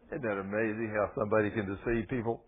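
usual speaking voice

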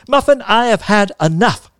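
loud, high-pitched scolding shout; furious, every word punched out; angry shout. A narrator's reading. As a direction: shout as an aunt at the end of her patience. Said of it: "she shouted".

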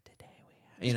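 mimicked ASMR hush, barely audible; imitated ASMR. Imitating a woman recording ASMR, hushed. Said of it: "doing, like, the thing".

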